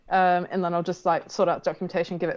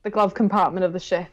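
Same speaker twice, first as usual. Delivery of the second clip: usual speaking voice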